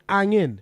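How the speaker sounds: "angin" with no H at the start; dropped H. Shown as a demonstration. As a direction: say "angin" starting straight on the vowel. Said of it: "Welsh people wouldn't pronounce the H".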